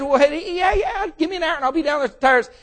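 high, quick, breezy friend; eager friend. Acted. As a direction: rush the easy promise. Friend answers on the phone.

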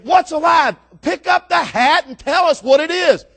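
acted onlookers hollering impatiently; exasperated crowd shout. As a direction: yell at the showman for an answer. Crowd demands answer from the showman.

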